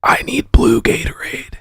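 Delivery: faint sickly whisper; pitiful whispered plea; weak whisper. Retold or imitated. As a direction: whisper it like you're too sick to talk. Sick, begging for Gatorade.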